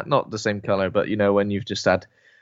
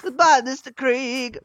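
much higher, slow falsetto farewell; mock sweet goodbye; 13 semitones higher, slower than usual. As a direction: say goodbye in a silly squeak. Acted farewell to neighbour.

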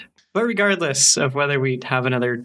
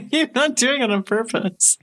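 stifled giggles; 9 semitones higher than usual. Real, not acted. Giggle fit mid-recording.

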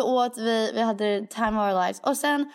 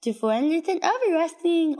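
imitating mom; 9 semitones higher than usual. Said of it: "mamma hela veckan har varit så här".

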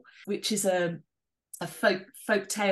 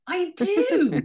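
high, loud, delighted agreement; excited and emphatic, pitched right up; real eager yes; 11 semitones higher, 6 dB louder than usual. Real, not acted. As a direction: jump at the offer with relish. Eagerly accepts offer of a joke.

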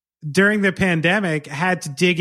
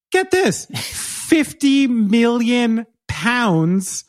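excited reveal; 6 semitones higher, 3 dB louder, much slower than usual. Real, not acted. Dramatic reveal of syrup figure.

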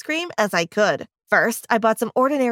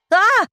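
short high scream, much higher and louder; startled scream; 11 semitones higher, 6 dB louder than usual. A narrator's reading. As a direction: yelp as a hand grabs your shoulder. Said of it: "I screamed while turning around".

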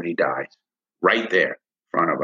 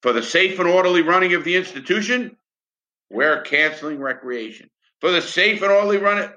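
higher, sing-song parody of prison notices; mock official wording; 8 semitones higher than usual. Ex-prisoner mocking the prison's official excuse, sing-song. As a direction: recite it like a smug memo. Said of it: "has a great wording that gets them out of trouble".

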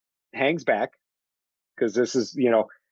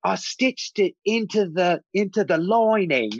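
higher, slower mock character voice; proud, drawn-out character line; lightly voiced proud character; put-on character voice; 6 semitones higher than usual. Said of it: "He's like".

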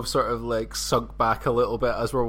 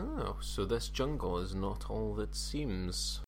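low, slow, hushed murmur; musing to himself; 4 semitones lower, 8 dB quieter, much slower than usual. Acted. Explorer murmuring to himself about the jungle, musing. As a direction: think aloud, softly drawing the words out. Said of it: "just whispering to himself".

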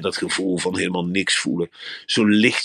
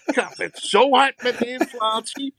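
outraged shopper. Acted. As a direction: rant high and scandalised at the price tags. Indignant shopper in the shop. (zeer hoog en verontwaardigd tierend over de prijzen)

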